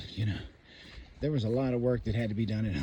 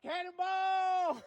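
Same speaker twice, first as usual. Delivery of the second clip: high drawn-out joyful yell; cannonball yell; 4 dB quieter, much slower than usual. Real, not acted. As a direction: stretch it out high, laughing. Own celebratory call.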